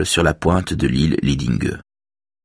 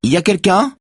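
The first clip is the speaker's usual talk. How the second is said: high-pitched narrated call; 14 semitones higher, 4 dB louder than usual. A narrator's reading. Woman calls down the corridor, high moderate shout. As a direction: call into the house to find someone. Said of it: "crie une femme".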